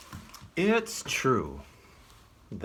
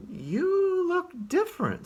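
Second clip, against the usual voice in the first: high, louder squirrel voice; 4 dB louder than usual. Acted. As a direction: squeak it out in surprise.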